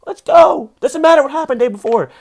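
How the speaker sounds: high, loud rallying cry; fired-up shout. Real, not acted. Comic hyping the audience, a loud high rallying cry. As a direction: yell it like a coach at halftime. Peak of pep talk, shouting.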